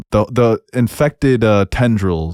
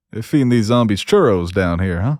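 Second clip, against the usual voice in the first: put-on playful Southern accent, pitched up toward the end; hammed-up Southern cowboy talk. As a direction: put on a Southern accent for a movie-hero line. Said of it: "Why are you speaking in a southern accent".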